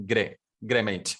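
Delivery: "G'day" squashed to "gre" before "mate"; Aussie pronunciation. Shown as a demonstration. Teacher answering his own pronunciation question, "G'day" said as "gre". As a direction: say "G'day" as one run-together "gre". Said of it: "The pronunciation will become what?".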